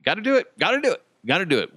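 hyped-up repeated self-urging; psyching up. Retold or imitated. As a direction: rush it like a mantra to push yourself. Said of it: "I was like".

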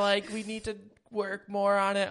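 high, loud, laughing mock scolding; mocking Amber. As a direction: ham up an exasperated partner, laughing through it. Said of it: "She's like".

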